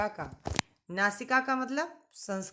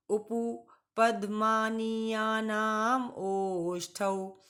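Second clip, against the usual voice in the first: slower second recitation, ओष्ठौ stretched out; repeat it again; much slower than usual. Shown as a demonstration. Teacher asks class to repeat the sutra slowly. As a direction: recite once more, slow and deliberate. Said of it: "एक बार फिर से बोलिएगा".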